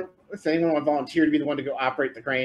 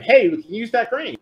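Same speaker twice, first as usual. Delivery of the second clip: higher, louder call to the group; calling to teammates; 9 semitones higher, 7 dB louder than usual. Acted. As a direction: toss out a raised-voice heads-up. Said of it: "Cal communicated to everyone".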